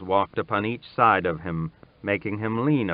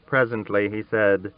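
usual speaking voice

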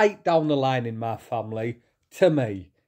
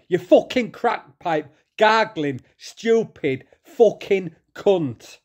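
real furious rant; 5 semitones higher, slower than usual. Real, not acted. Vlogger swearing at the man defending Shadow, furious. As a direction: lose your temper and swear at him. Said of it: "I'm so fucking angry".

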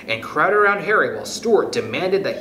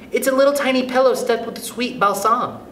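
put-on high-pitched little girl voice; little girl character; 10 semitones higher than usual. A narrator's reading. Catherine shows Stuart her pillow, high girlish voice. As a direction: answer high, as a small girl.

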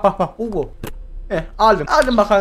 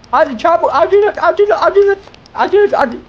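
frantic hurrying; 6 semitones higher, 4 dB louder than usual. Real, not acted. Racing the countdown.

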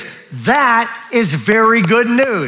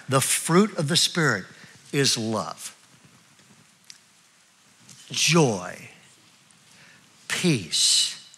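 each word softer, with long pauses between; slow, soft reading; 6 semitones lower, 6 dB quieter, slower than usual. Shown as a demonstration. Pastor to congregation, reading the list slowly and softly. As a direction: let every word sink in, slowly and softly. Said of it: "I'm gonna read these things slow".

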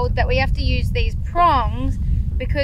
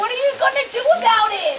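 high furious scolding; angry yelling; 11 semitones higher, faster than usual. Real, not acted. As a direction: yell at him, outraged. Toilet flooded, scolding Zach.

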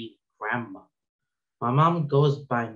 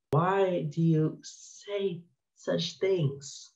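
mock parent; 4 semitones higher, 4 dB quieter, much slower than usual. Mock parent asking slowly.

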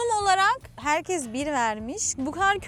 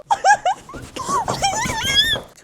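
shrieking laugh-cry; 7 dB louder than usual. Real, not acted. Bedbugs found, shrieking.

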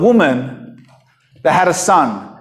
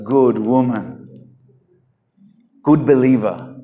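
tender praise; 6 semitones lower than usual. Real, not acted. Preacher praising the widow.

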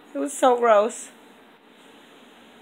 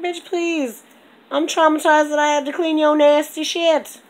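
louder, higher mock outrage; sassy retort; 8 semitones higher, 9 dB louder than usual. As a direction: snap back at the cat with loud sass. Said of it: "I'm like".

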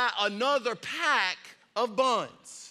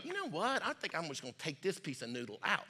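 much softer, quick mock musing; throwaway mumble of a clueless amateur; offhand musing; 5 semitones lower, 10 dB quieter, faster than usual. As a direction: think aloud casually, dropping low. Acts a casual amateur brain surgeon.

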